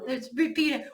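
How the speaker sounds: usual speaking voice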